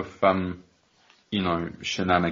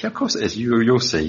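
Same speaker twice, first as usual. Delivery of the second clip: imitating a cocky high-pitched reply; mock-confident "you'll see" to a doubting friend; confident retort; 4 semitones higher, 5 dB louder than usual. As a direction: jump up high and sure of yourself.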